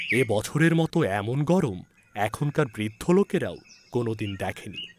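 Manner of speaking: ordinary delivery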